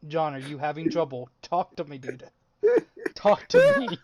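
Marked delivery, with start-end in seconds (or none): 3.1-4.0 loud, high, desperate; high pleading shout. Real, not acted. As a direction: call out pleadingly. Urging silent friend.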